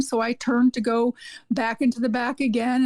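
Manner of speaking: usual speaking voice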